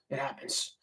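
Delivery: faint whispered shrug; low, soft, barely voiced; lost voice. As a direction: say it with the voice gone. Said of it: "I lose my voice a little bit".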